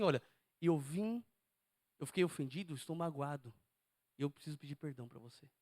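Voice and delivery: low, softening, measured confession; humble apology. Acted. Offended man asking his friend's forgiveness, humble and soft.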